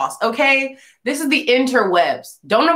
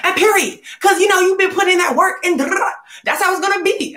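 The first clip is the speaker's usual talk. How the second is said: gas her up with a shout; mock hype shout; hyped-up friend; 10 semitones higher than usual. Friend's hype quoted, high shout.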